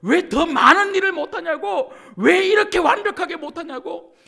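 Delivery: very loud, high mock rebuke; acted-out angry dressing-down; scolding. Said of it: "이렇게 혼내시는 분이 아니세요".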